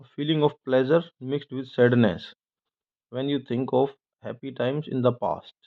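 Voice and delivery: very slow, careful dictionary reading; slow definition read. Shown as a demonstration. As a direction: read each word out slowly for students. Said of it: "reading dictionary definition".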